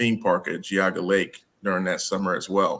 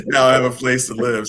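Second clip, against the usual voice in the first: ordinary talk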